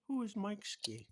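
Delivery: high, slow who is Mike Ski; mock boss question. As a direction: drag out a high incredulous question. Bosses question his name tag.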